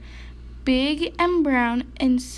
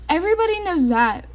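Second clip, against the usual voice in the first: fast, higher growl of impatience; 6 semitones higher, 4 dB louder, much faster than usual. A narrator's reading. Grumpy bear scolding the cheerful mouse, fast growl. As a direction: snap it out quickly. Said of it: "growled Bear".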